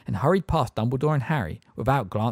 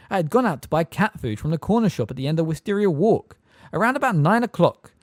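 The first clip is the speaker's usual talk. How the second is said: gabbled; 7 semitones higher, 4 dB louder than usual. A narrator's reading. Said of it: "gabbled Miss Fig at once".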